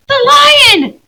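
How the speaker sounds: high, loud burst of discovery; excited girl. Acted. Lucy telling her siblings she saw Aslan, high and thrilled. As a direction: blurt it out, thrilled. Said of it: "said Lucy".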